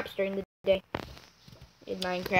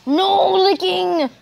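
slow, higher, louder laughing command; a laughing, drawn-out shout at the dog; mock scolding shout; 7 semitones higher, 5 dB louder, much slower than usual. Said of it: "And I'm like".

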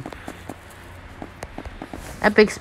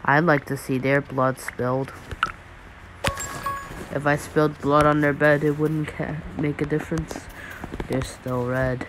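low, soft menacing killer voice; slasher killer; 8 semitones lower, 10 dB quieter than usual. Acted. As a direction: murmur it like a calm psycho. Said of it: "Why am I talking like a serial killer?".

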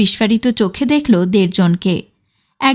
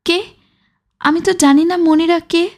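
higher-pitched, baffled questioning; bewildered; 6 semitones higher than usual. A narrator's reading. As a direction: sound dumbfounded, pitch lifted. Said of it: "হতভম্ব হয়ে বলল". (হতভম্ব, চড়া সুরে)

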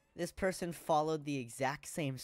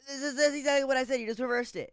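loud, high, fast protest; exasperated shout; 11 semitones higher, 8 dB louder, faster than usual. Real, not acted. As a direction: shout it in disbelief. Exasperated in argument.

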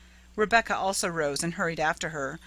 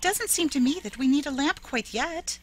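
put-on higher, pleading like a small child; piteous childlike plea; 9 semitones higher than usual. A narrator's reading. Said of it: "in a piteous, pleading voice like a child's".

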